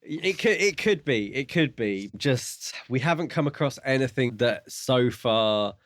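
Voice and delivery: ordinary delivery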